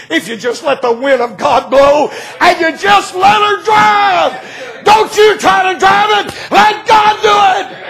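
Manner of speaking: high shouted preacher's cry; preaching at full force. Acted. Preacher urging the church to trust God, shouting sermon. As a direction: lift it to a shout, let God drive. Preaching climax about God's wind.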